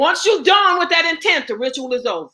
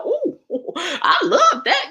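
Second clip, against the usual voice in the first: slow laughing mock delight; mocking a sugar lover; 5 semitones higher, slower than usual. Mother mocking people hooked on sugar, laughing. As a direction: ham up the dumb delight, giggling. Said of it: "we go".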